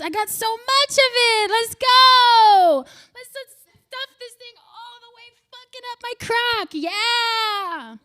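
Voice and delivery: high, loud excited boast; hyped-up boast. Acted. Comic hyping her own look, high loud boast. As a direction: get amped about it.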